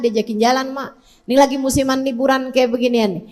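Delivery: usual speaking voice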